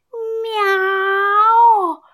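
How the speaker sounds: kitten meow. A narrator's reading. Kitten meows.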